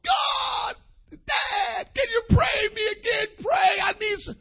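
high, loud crying plea; wept bitterly. Retold or imitated. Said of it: "The Bible said he wept bitterly".

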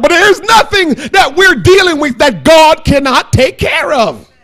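high, forceful sermon delivery, dropping at the close. Real, not acted. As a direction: proclaim it firmly to the room.

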